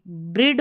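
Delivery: ब्रिड with a short i; wrong trial reading. Shown as a demonstration. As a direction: try ब्रिड with a quick short vowel. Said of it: "तो ये ब्रेड है". (गलत विकल्प: ब्रिड, छोटी इ के साथ)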